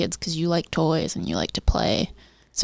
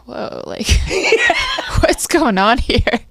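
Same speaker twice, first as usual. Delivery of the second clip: a startled "whoa" that breaks into a laugh; mock bewildered brother; 6 semitones higher than usual. Brother puzzled at the grown man's excitement, amused. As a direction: play the confused brother, laughing. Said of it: "look at you like".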